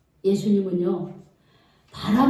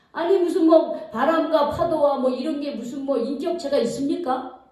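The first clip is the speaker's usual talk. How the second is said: usual speaking voice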